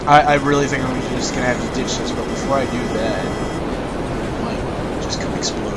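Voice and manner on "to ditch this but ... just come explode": slow, soft scheming whisper. Real, not acted. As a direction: whisper it like a secret plan. Plotting quietly in game.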